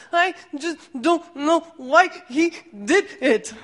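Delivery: put-on high, laughing little-kid whine; little brother upset about the bee, high laughing wail; little boy's wail. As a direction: wail it like a hurt small boy.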